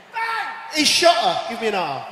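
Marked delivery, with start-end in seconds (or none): 0.1-0.5 shouted high bang; gunshot bang. Acted. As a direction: yell the gunshot out. Gunshot in robbery joke.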